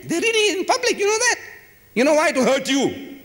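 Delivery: rising outrage at the crowd; indignant outburst. Real, not acted.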